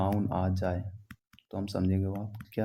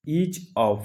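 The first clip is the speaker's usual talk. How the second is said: each said higher and louder; each of with weight on each; emphasis on each; 5 semitones higher, 5 dB louder than usual. Shown as a demonstration. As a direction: punch each. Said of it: "उस पे emphasis डाल रहे हो".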